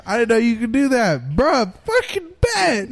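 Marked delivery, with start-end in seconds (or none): 1.3-2.9 slow, high, stretched-out hype; hyped. Real, not acted. Host hyped about bleeping, slow high bet. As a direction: drag it out, thrilled.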